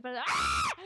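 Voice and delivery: sudden sharp cry of pain, loud; pained yelp. Retold or imitated. Retelling the foot being touched, a loud yelp.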